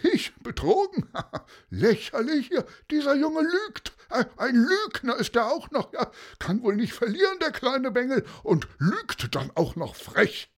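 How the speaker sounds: very high-pitched mock indignation; scoffing denial. A narrator's reading. Cheat calls the boy a liar, scoffing denial. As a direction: laugh it off and accuse the accuser.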